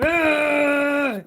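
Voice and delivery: loud, strained groan of disgust; disgusted ugh. Real, not acted. Reader groaning at the ex who drained her, loud disgusted ugh. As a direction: let out a fed-up, pained ugh. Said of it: "that's how I feel about him".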